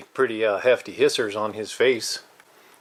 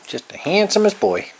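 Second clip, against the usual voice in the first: gushing praise; 7 semitones higher than usual. Real, not acted. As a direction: lift it up, beaming with praise. Praising cat, repeated louder.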